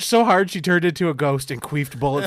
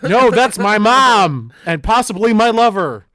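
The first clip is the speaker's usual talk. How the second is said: louder, higher, slow creepy voice; Old Greg; 5 semitones higher, 10 dB louder, slower than usual. Retold or imitated. Old Greg grabbing the woman in the movie, loud, slow and creepy. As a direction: draw out the confession loud and strange. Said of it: "and then he's like".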